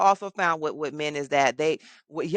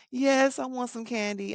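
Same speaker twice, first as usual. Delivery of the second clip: put-on higher, eager little-boy voice; little boy's answer; 8 semitones higher than usual. Boy in the office perks up at candy, higher eager voice. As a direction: answer like a kid offered candy.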